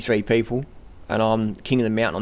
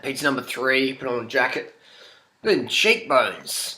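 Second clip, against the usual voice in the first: usual speaking voice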